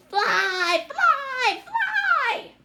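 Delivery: a crowd of trolls yelling her on; shouting trolls. Acted. Trolls shouting at the girl to fly, squeaky high and loud. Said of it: "they shouted at me".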